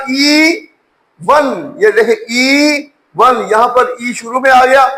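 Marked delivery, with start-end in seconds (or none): 0.1-1.7 "ई वन" split, ee held long then van; separate long E. Shown as a demonstration. Teacher showing E stands apart, "ई" long then "वन". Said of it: "ई long sound देगा". 2.3-3.5 "ई वन" with a long slow ee; separate long E. Shown as a demonstration. Teacher showing E stands apart, "ई" drawn out before "वन". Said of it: "ई अपने आप को separate रखे हुए हैं".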